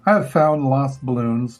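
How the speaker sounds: plain reading voice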